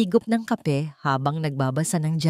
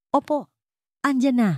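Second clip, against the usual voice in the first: bright, quick call back to a customer; cheerful reply from the café counter; lively answer; 8 semitones higher than usual. A narrator's reading. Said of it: "masiglang sagot ni Liwayway".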